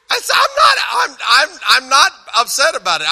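high, loud, stammering protest; mock-upset protest. Speaker insists to his corrector he isn't upset, mock protest. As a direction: protest too much, playfully worked up.

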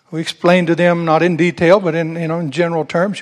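normal talking voice

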